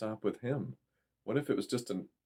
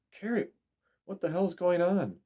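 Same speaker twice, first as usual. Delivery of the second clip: yell; 10 semitones higher than usual. A narrator's reading. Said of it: "and yelled".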